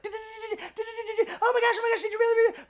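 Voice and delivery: very high squeaky gabble; mock falsetto babbling of excited texts; chatterbox girl. Shy girl texting eight hundred messages, squeaky chatterbox gabble. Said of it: "like, a huge chatterbox".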